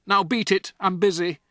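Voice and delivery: loud, brushing-off dad; dismissive father. Acted. Father dismissing Matilda, loud and curt. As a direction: wave her away.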